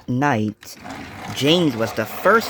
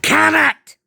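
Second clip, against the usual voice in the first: much higher, louder angry snap; snapped; 13 semitones higher, 6 dB louder than usual. A narrator's reading. James snaps at Gordon, angry. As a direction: bite his head off. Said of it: "James had never snapped".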